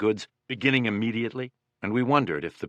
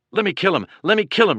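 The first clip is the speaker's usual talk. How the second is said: frantic shout, much higher and louder; 9 semitones higher, 6 dB louder, faster than usual. A narrator's reading. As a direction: yell it, desperate to kill. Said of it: "shouted Vest".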